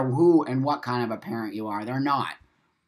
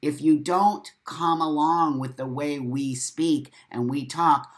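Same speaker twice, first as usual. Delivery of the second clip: usual speaking voice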